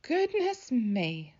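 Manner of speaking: drawn-out, surprised grandmother; surprised Gran. A narrator's reading. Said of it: "said a voice".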